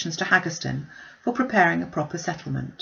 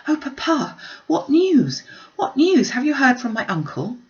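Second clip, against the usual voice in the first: much higher and louder, urgent and eager; eager breathless cry; 7 semitones higher, 4 dB louder than usual. A narrator's reading. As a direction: rush at her father with breathless questions. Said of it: "panting for breath ... eagerly cried out".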